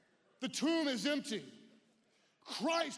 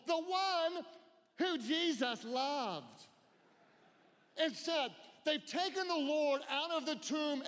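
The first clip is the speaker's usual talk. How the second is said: preaching at full force; 5 semitones higher than usual. Acted. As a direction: preach it out to the whole hall. Preacher reading Gospel aloud.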